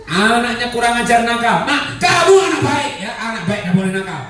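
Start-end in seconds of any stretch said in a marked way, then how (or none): 1.7-4.2 high mock yell of a mother; positive parental yell. Shown as a demonstration. Mother yelling at naughty child with kind words, high mock yell. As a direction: pitch it up like a parent hollering. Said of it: "Teriak nggak apa-apa".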